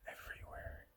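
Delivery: hushed whisper. Real, not acted. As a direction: whisper so the bees stay calm. Whispering near swarm of bees.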